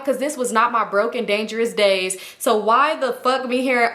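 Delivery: usual speaking voice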